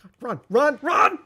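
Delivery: panicked high yell to run; being chased. Real, not acted. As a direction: shout while fleeing. Fleeing in game.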